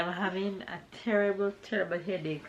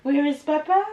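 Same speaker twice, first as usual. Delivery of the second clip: high, sing-song mommy voice; 10 semitones higher than usual. Real, not acted. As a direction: pitch it up for a little one. Mother talking to her toddler.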